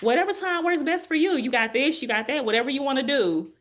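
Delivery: high, easygoing doctor voice; imitating her doctor. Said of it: "she was like".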